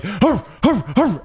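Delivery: gruff dog-like barks at a stranger; growled hurr hurr barks; barking. Retold or imitated. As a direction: bark it out like a guard dog. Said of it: "started barking at him".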